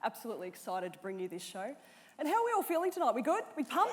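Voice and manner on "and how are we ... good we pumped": high, bright crowd warm-up, pitched up; hyping the crowd. Real, not acted. Host firing up the theatre crowd, eager and upbeat. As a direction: pump up the audience with rising questions.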